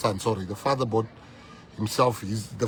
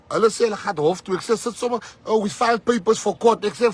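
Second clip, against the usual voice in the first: high, loud and fast; rally the crowd with heat; fired-up retelling; 10 semitones higher, 6 dB louder than usual. Real, not acted. Telling the crowd about the DA, fired-up loud retelling.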